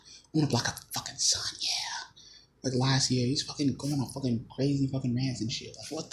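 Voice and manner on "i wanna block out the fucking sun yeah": imitating Bill Gates in a creepy whisper. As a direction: hush it like a villain's plan. Quoting Bill Gates' creepy claims.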